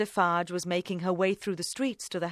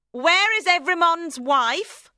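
loud, high, slow demand; imperious, forceful interrogation; 10 semitones higher, 8 dB louder, slower than usual. A narrator's reading. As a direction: demand an answer, drawing out each word. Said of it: "she demanded".

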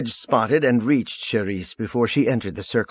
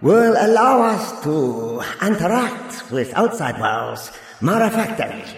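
grand ceremonial speech, pitched up; orating ruler; 8 semitones higher than usual. Acted. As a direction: proclaim to a vast crowd, stretching the key words. Voices the Parator's speech.

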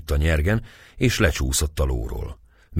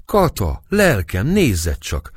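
higher, louder cheerful call to his wife; calling out laughing; 7 semitones higher, 4 dB louder, slower than usual. A narrator's reading. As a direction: call her over with a laugh in the voice. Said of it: "kiáltott Török Bálint nevetve".

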